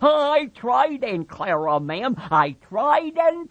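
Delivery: put-on high childlike man's voice, pleading; Lenny pleading. Lenny pleading to Aunt Clara.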